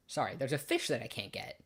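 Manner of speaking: ordinary talk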